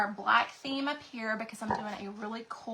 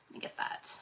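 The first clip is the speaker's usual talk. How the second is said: whispered aside; 10 dB quieter than usual. Real, not acted. Aside while reaching for something.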